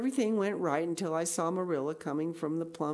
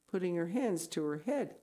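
ordinary delivery

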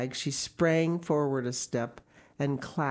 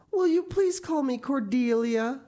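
higher, louder, hopeful rise; eager child; 12 semitones higher, 5 dB louder than usual. A narrator's reading. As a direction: ask with bright eagerness. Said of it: "she said eagerly".

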